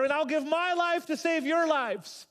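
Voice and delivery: high, loud, desperate sacrifice; desperate offer. Acted. Jonah offering his life to save the sailors, desperate. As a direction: offer your life in a frightened rush. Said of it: "Jonah's terrified".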